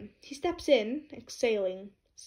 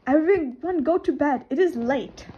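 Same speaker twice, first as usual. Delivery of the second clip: loud, raised, emphatic order; commanding order; 4 semitones higher, 7 dB louder than usual. Acted. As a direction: call the whole group to bed with authority. Class rep ordering all to bed.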